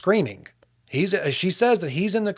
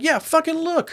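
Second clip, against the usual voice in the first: much higher, indignant mimicry; retold defiant challenge; 12 semitones higher than usual. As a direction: act out a defensive "go ahead and check". Accused man hands over phone, retold.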